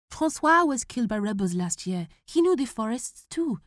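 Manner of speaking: fearful son; worried boy. Acted. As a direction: warn him earnestly. Boy warning father.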